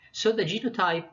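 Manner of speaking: ordinary talk, nothing marked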